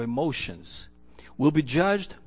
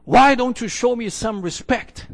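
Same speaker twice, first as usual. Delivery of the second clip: raised, louder quote of an angry demand; retold yell; 5 semitones higher, 5 dB louder than usual. Young man yelling at an older lady at the bus stop, retold yell. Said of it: "he yelled at her".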